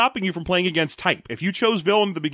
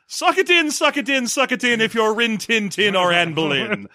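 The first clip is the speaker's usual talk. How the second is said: high, hammy stage declamation; dramatic soliloquy; 5 semitones higher than usual. Pretend. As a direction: overact a Shakespeare speech. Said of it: "fucking soliloquy right now".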